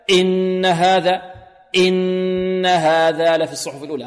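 "إن" punched loud on its first sound; jabbed hamza. Shown as a demonstration. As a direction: hit the glottal onset of "إن" too hard, chanting. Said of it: "البعض يلكز الهمزة". (لكز همزة "إن")

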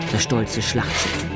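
usual speaking voice

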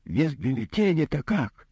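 high-pitched protesting question; patient objecting, half outraged; almost indignant. A narrator's reading. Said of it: "почти возмущённо произнёс". (возмущённый вопрос)

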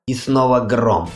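plain reading voice

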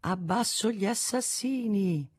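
high, slow, hushed shout; stifled, drawn-out protest cry; distant mock cry. A narrator's reading. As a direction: call it out slowly with the volume held back. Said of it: "Qualcuno gridò".

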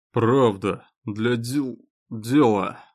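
slurred, tripping over the word; tongue-tied drunk insisting; drunk slurring. Acted. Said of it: "Заплетающимся языком Совух".

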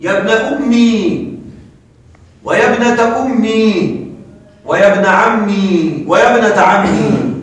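يا ابن أمي slowly with the final ya clearly kept. Shown as a demonstration. Teacher to students slowly showing the third form, ya kept. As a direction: draw out each phrase and sound the ya at the end. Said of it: "إثبات الياء".

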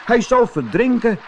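put-on high, alarmed child's voice; boy fearing the kitten will drown; boy calling out. A narrator's reading. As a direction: cry out in worry like a boy. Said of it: "riep een van de jongens".

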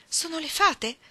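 Amy asks in high, wide-eyed wonder; a child gasping at a magic surprise; wondering question. Acted. Amy to her sisters at the feast, high amazed question. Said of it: "domandò Amy".

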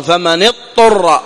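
slow فمن اضطر with the ض blurred; wrong merged reading. Shown as a demonstration. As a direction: let the ض of اضطر slide away. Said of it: "انظر كيف يحصل إدغام".

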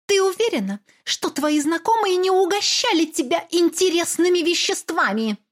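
high, poisonous insinuation; venomous question. Acted. Mother accusing her daughter of drugs, venomous. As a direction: drip sarcasm on every word. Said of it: "ядовито спросила мама".

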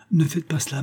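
mild alarmed outcry, barring the way; exclaiming. A narrator's reading. Servant blocks Augustine from going upstairs, a mild urgent cry. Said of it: "s'écria Marie Procule".

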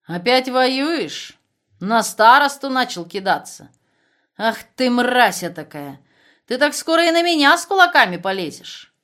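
loud furious tirade; angry. Acted. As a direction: tear into him, furious. Commandant berates prisoner. (яростный разнос)

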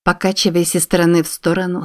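usual speaking voice

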